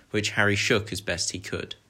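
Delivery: usual speaking voice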